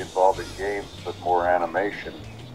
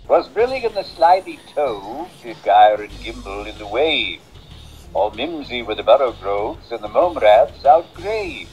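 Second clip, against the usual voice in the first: high, arrogant, flippant character voice; Moneybags; 5 semitones higher than usual. Shown as a demonstration. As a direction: play him haughty and flippant, pitched up with swooping tune. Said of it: "I remember the name Moneybags. He was a very arrogant character".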